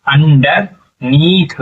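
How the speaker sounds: Underneath stretched very slowly, each syllable drawn out; slow sounding out. Shown as a demonstration. Teacher blending the word for pupils, very slow underneath. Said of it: "क्या हो जाएगा?".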